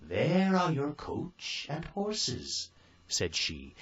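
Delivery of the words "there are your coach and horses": high, slow, kindly fairy voice; fairy godmother. A narrator's reading. Fairy godmother giving Cinderella orders, high and slow. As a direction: lift the voice high and take it slowly.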